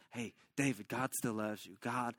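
low, soft reassurance; acted tender pastoral comfort; comforting friend. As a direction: console gently, as if holding a friend. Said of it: "He's embracing him".

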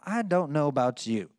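usual speaking voice

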